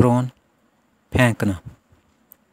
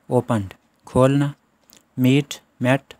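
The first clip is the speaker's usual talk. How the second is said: ordinary talk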